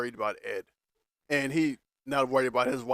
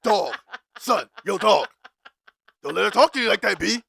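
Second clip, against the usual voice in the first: a lightly voiced streetwise bro, higher and louder; imitating Rob's slang; 5 semitones higher, 5 dB louder than usual. Mocking Rob's tough slang to Michael, hyped bro voice. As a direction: mimic a guy suddenly talking tough slang. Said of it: "he act like he dark skinned".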